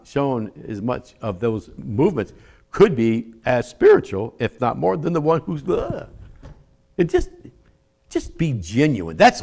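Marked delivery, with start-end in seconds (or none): none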